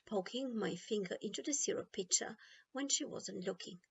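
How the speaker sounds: ordinary delivery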